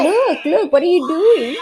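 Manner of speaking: playful impression of a curious child; imitating a small child. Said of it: "She's like".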